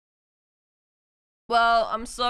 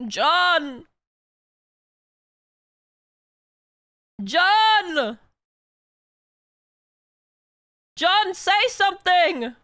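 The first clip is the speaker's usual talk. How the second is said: calling out loudly; 3 semitones higher, 6 dB louder, slower than usual. Real, not acted. Streamer loudly calling winner John to speak up. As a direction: shout for John again and again to answer.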